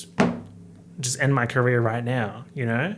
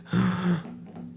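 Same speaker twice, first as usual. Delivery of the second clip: gasp 1; 6 semitones higher than usual. Real, not acted. Sudden realisation.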